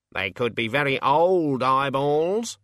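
high, slow defiant bird; defiant raven. A narrator's reading. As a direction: dig in stubbornly as the raven. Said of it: "said the raven defiantly".

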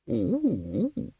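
a soft ape hoot; orangutan ook. A narrator's reading. Orangutan's noise.

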